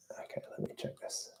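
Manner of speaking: hushed whispered aside; whispering. Real, not acted. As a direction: whisper it under your breath. Muttering to self while checking.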